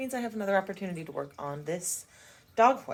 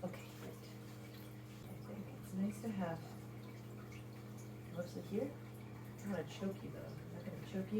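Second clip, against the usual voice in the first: quiet; 17 dB quieter than usual. Real, not acted. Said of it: "had to be pretty quiet upstairs".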